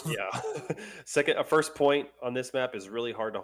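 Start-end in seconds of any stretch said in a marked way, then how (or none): 0.5-1.0 short high laugh. Real, not acted. Short laugh at own slip.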